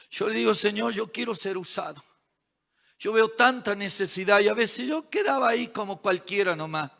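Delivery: hushed, earnest testimony; confessional preaching. Real, not acted. Preacher confessing he was just another religious man, softly earnest. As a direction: drop the volume and confide in the congregation.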